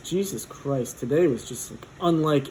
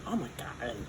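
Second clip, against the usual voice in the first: quiet awed aside; 2 semitones lower, 12 dB quieter than usual. Retold or imitated. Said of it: "I'm like".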